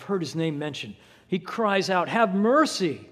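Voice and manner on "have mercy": louder, higher plea for mercy; crying out. Acted. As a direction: call out over the crowd. Earlier plainer plea.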